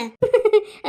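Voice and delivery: high, loud burst of laughter; loud amused cackle. Acted. Amused reply to niece's excuse.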